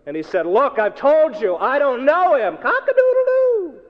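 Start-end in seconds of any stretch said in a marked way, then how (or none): 0.5-2.6 loud, slow, high protest; exasperated denial. Retold or imitated. Peter to the little girl, loudly exasperated. 2.6-3.8 loud, very high cockcrow; rooster crow. Acted. Rooster sounding right after Peter's denial, crowing loudly.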